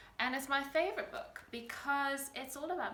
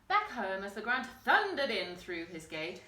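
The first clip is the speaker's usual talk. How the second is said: ordinary delivery, nothing marked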